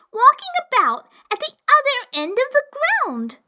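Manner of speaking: put-on high, fretful little girl; young girl voice. A narrator's reading. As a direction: complain breathlessly like a child. Alice complains to cat.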